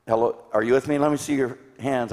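ordinary talk

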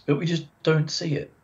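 ordinary talk, nothing marked

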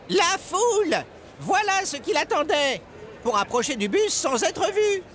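very high, excited character voice; Edgar, sudden realisation. A narrator's reading. Edgar realises the rabbit's plan, high and alarmed. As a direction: blurt out the answer, high and urgent.

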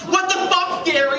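very high, shocked cry; disbelieving yelp at the news; shocked outburst. Retold or imitated. Artist reacting to Gary's daughter news, high shocked cry.

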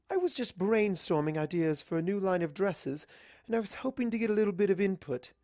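high, quick and chatty; Rarity's voice. Acted. Rarity explaining her new dress line, high and quick. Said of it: "she said as she lifted".

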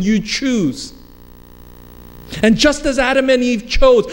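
high-pitched, urgent pulpit exhortation; impassioned preaching. Real, not acted. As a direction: press the congregation hard, clause upon clause.

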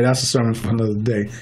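normal talking voice

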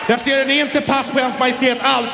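put-on high squeaky kid voices bickering; childish bickering. Two rivals bickering like kids over the king, squeaky high.

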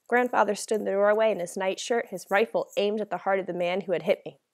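plain reading voice

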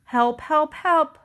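high, loud storybook cry; crying for help. A narrator's reading. Little Blue Truck is stuck in mud, cried out. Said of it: "cried the Little Blue Truck".

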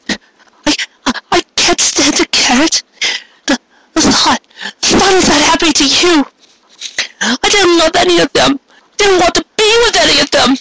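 put-on high young mare's voice, halting and upset; young mare, distressed. A narrator's reading. Mare tells Carrot she loved none of them, halting and upset. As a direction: voice her high, stumbling over the words.

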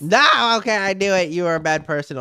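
loud, high refusal; loud outburst. Acted. Joshy loudly calling the girlfriend a bad person. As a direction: blurt it out loudly. Rejecting the kiss again.

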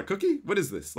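normal talking voice

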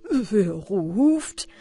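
a lightly voiced horse calls down; horse asking. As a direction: ask down the shaft as the horse.

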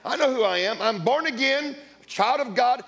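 ordinary talk, nothing marked

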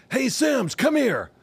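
high mock call from across the room; coach calling him over. Head coach calls the quarterback over, high ominous call. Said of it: "I could hear the tone of the voice".

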